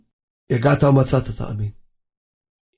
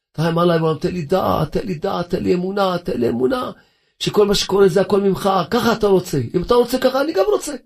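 rising, ever higher plea to God; a supplicant climbing higher with each plea; pleading prayer; 6 semitones higher than usual. Shown as a demonstration. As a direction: beg God, climbing in pitch. Preacher acts out surrendering prayer.

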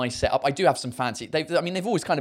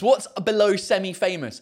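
ordinary delivery

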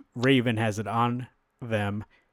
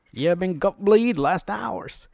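higher, louder hammed-up villain voice; menacing villain taunt; 8 semitones higher, 4 dB louder than usual. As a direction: sneer the threat at him like a cartoon killer. Villain taunting Bruce in fight.

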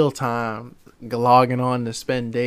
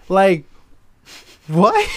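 loud, high disbelief; baffled outburst; incredulous; 7 semitones higher, 7 dB louder than usual. Real, not acted. Disbelief at the scene.

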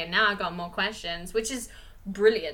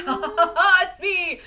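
high, loud gloating villain laugh; villain cackle; 9 semitones higher, 9 dB louder than usual. Retold or imitated. The villain gloats at Harley, a loud high cackle. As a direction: cackle like a cartoon baddie unmasking. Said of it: "And then he's like".

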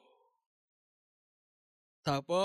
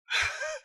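high laugh. Real, not acted. Host laughs at Doc.

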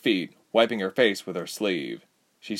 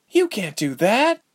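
plain reading voice

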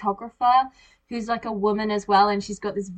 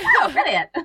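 excited; 7 semitones higher, 6 dB louder than usual. Retold or imitated. Retold excited quote.

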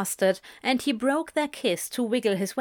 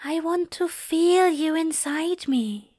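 intimate secret; 7 semitones higher than usual. A narrator's reading. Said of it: "whispered his next words like the secret".

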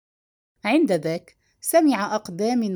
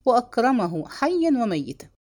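plain reading voice

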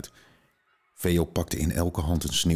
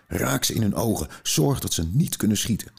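put-on higher, louder orders to the children; urgent command; 6 semitones higher, 3 dB louder than usual. A narrator's reading. Veo urges the children during the attack, urgent shout. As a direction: shout instructions in the middle of the fight.

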